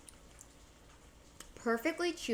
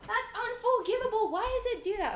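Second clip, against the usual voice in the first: dismayed complaint; 9 semitones higher, 6 dB louder than usual. Real, not acted. Streamer dismayed at a stream glitch.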